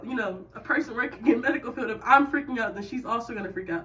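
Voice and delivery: ordinary talk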